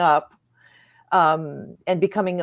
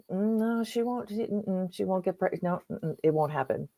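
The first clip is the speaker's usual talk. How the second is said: softer, hushed inner certainty; 9 dB quieter than usual. Retold or imitated. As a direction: quietly voice your inner knowing. Inner knowing voice, retold.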